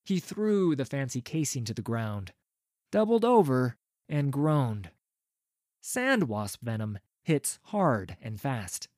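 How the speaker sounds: usual speaking voice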